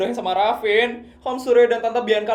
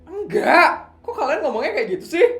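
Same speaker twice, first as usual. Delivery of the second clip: laughing protest; 9 semitones higher, 4 dB louder than usual. Acted. Friends tease about bad news.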